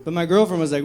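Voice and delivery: ordinary delivery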